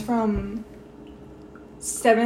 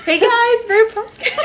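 high, louder host greeting; mock podcast intro; 10 semitones higher, 6 dB louder, slower than usual. Joking they forgot to introduce the podcast, hammed-up host voice. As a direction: ham up a cheery host welcome. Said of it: "We were just like".

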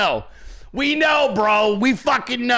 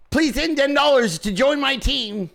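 higher-pitched mock fundraising plea; mocking crooked-jaw politician; 5 semitones higher than usual. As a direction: imitate a pleading, lopsided-mouthed politician. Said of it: "why does his mouth do that thing?".

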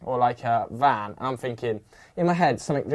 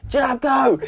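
urgent yell; 10 semitones higher, 3 dB louder than usual. Retold or imitated. Urging friend to flee the truck.

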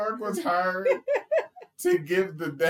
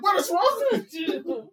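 high-pitched exasperated cry; mock outraged shriek; exasperated outburst; 11 semitones higher than usual. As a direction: fling it out high and disbelieving. Retelling an exasperated character's outburst.

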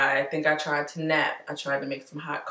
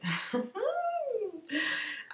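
laughter; 7 dB quieter than usual. Real, not acted. Laughs mid-reading.

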